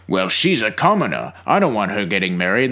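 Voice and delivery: low pompous-king impression. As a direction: play a king, pitched well down. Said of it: "the king is like".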